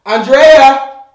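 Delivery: loud urgent shout for someone; calling out. Pretend. Man yelling for partner from the bathroom, calling out. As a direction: holler the name to summon them.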